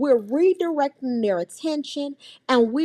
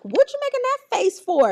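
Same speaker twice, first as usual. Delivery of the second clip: teasing aunt; 12 semitones higher than usual. Retold or imitated. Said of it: "and I'm like".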